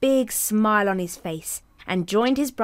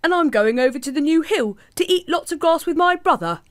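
put-on higher, louder young goat; goat's bigger voice; 8 semitones higher, 5 dB louder than usual. Second goat answering the troll, higher and louder. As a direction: answer up bright and loud. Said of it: "who didn't have such a small voice".

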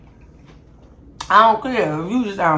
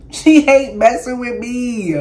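slow, louder, laughing through the words; 7 semitones higher, 9 dB louder, slower than usual. Real, not acted. Teasing the friend off camera, laughing loudly.

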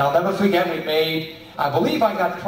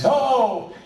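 high, drawn-out chorus-like no; lightly mimicked schoolchildren, pitched up; class of kids; 6 semitones higher than usual. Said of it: "about fifteen kids in the class".

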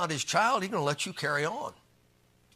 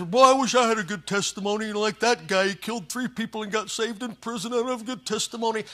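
mock envious churchgoer; 5 semitones higher, 4 dB louder than usual. Church member envies dramatic testimony.

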